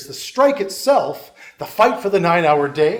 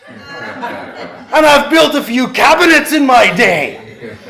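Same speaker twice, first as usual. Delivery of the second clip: a hammed-up, bad Scots accent that climbs higher and louder by the end; a mock Scottish politician winning over the crowd; terrible Scots accent; 4 semitones higher, 5 dB louder than usual. Lecturer quoting MacDonald to union workers in a bad Scots accent. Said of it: "so I'll do a terrible Scots accent".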